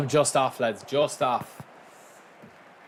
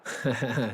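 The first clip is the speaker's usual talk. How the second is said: chuckle; 4 dB quieter than usual. Real, not acted. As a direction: let out a short string of chuckles. Laughs to himself while gaming.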